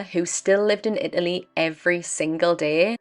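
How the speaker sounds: normal talking voice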